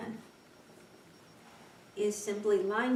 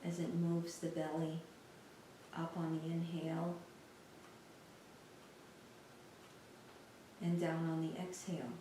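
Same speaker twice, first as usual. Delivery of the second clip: soft, unhurried meditation voice with long pauses; guided meditation; 2 semitones lower, 10 dB quieter than usual. Real, not acted. Teacher guiding listeners through a lying-down body scan, calm meditation voice. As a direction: guide them gently through a body scan, leaving space between phrases.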